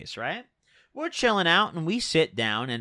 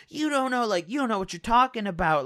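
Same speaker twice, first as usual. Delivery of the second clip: higher-pitched imitation of her snapping back; mock mean retort; 6 semitones higher than usual. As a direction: mimic her defensive comeback, pitched up. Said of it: "gave me the meanest fucking look".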